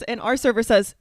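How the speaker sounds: ordinary talk, nothing marked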